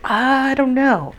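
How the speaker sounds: high, slow, drawn-out puzzled whine; mock helpless shrug in the voice; baffled I don't know. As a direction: stretch it out, lost and clueless. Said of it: "'cause you're like".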